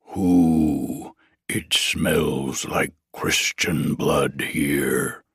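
low, slow growling troll. Acted. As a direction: rumble it out like a big troll.

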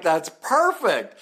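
drawn-out, high and laughing; laughing delight. Real, not acted. Podcaster cracking up at a comment, slow laughing delight. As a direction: savor the line while cracking up. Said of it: "I just lost it".

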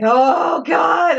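shocked cry. Retold or imitated. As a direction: blurt it out as the movie punch lands. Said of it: "I literally was like".

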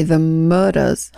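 slow Southern "Murdas", r dropped; Southern accent. Retold or imitated. Telling listeners the family's name, Southern accent. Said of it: "is how they say their name".

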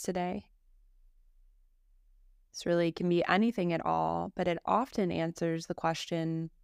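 calm, even yoga nidra guidance with long pauses; guided meditation. Acted. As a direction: guide a listener gently into rest, phrase by phrase.

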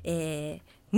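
ordinary delivery

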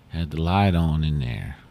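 usual speaking voice

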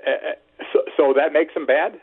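usual speaking voice

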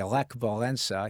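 Lech Walesa with a lazy soft L; lazy L imitation. Voice actor imitating a newsman's lazy L in Lech Walesa. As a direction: roll the L in Lech Walesa lazily. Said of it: "Tom Brokaw would say".

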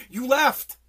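loud repeated accusation, rising pitch; shouting an accusation over and over; angry accusation. Pretend. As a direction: hammer it home like a chant of blame. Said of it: "I could say".

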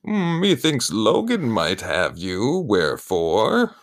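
slow, hammy Shakespearean voice; mock olde english. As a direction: play a fake Elizabethan actor, drawn out. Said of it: "weird Shakespearean talk".

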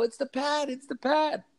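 put-on high, childlike call of the kids; kids' chant, lightly high; excited kids' voice. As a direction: pipe it up like small kids at a show. Kids shouting at a show.

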